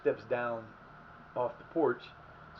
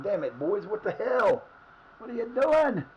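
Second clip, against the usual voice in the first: higher, louder angry outburst; frustrated yelling; 9 semitones higher, 7 dB louder, slower than usual. Real, not acted. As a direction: yell at the screen in frustration. Team concedes, he yells.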